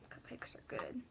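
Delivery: breathy whispered aside. Real, not acted. Aside to viewers about cupcakes, whispered. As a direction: whisper it to yourself.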